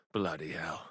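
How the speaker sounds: very soft, low curse under the breath; drained low grumble; muttered curse. Acted. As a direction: mutter it to yourself. Relief after near death.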